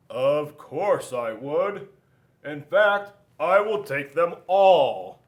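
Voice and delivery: a giant who wants every donut; loud slow boastful whale voice; big greedy whale. Acted.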